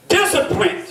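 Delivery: high, ringing "Discipline"; proclaimed. Real, not acted. Proclaiming the key word.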